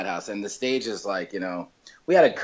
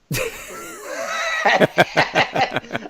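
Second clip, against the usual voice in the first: big laugh; 12 semitones higher than usual. Real, not acted. Laughing at his own punchline.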